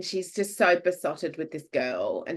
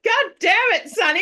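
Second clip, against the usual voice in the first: much higher, louder frustrated cry at the dog; exasperated yell; 9 dB louder than usual. Retold or imitated. Owner yelling at her dog, exasperated. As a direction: scold the dog sharply.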